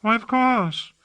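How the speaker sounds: high, airy surprise; Hawke, lightly surprised. A narrator's reading. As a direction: act as if it goes without saying. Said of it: "Hawke seemed surprised".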